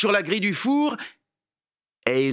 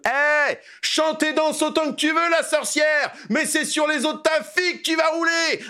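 taunting shout; 10 semitones higher than usual. Acted. Dégourdi yells a high taunt at Baba Yaga. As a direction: crow it at her.

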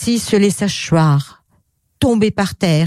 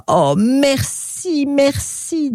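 overflowing with joy; high, drawn-out joyful thanks; Pixie; 7 semitones higher, much slower than usual. Acted. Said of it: "débordant de joie". (merci aigu et étiré, débordant de joie)

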